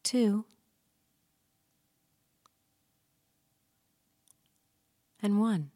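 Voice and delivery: long pauses between numbers; slow soothing countdown. Acted. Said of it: "I'm going to count down from ten to one".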